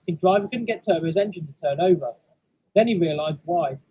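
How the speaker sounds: ordinary delivery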